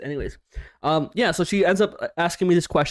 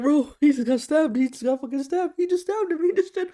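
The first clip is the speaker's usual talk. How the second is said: rising higher and higher in panic; freaking out; 9 semitones higher than usual. Retold or imitated. Telling friends about the stabbing, panicked and getting higher. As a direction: spill it out, more shocked each line. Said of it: "losing my shit".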